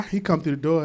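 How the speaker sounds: usual speaking voice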